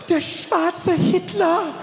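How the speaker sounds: gasp, then high, slow horrified German; petrified. Retold or imitated. Shop woman staring at customer, petrified. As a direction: drag it out in mock terror, pitched high. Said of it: "She was petrified".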